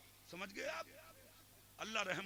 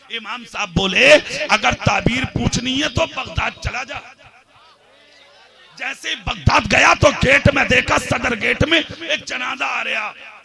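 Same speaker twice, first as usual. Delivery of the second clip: much louder sermon delivery; full-force preaching; 3 semitones higher, 21 dB louder than usual. Acted. Preacher telling the crowd the dream story, roaring sermon. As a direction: roar the tale over the gathering.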